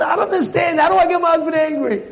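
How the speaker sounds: high, comic exasperation; baffled wife. Acted. Wife phoning a friend about calm husband, exasperated. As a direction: complain in a high, hurried pitch.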